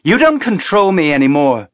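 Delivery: high, loud defiant cry; shouted. A narrator's reading. Teresa shouting at the colonel, defiant shout. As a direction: shout it to break free. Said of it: "Teresa shouted".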